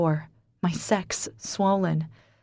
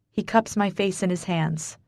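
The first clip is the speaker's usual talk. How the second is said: ordinary delivery, nothing marked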